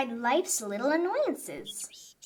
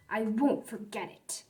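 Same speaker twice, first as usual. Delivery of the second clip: ordinary delivery, nothing marked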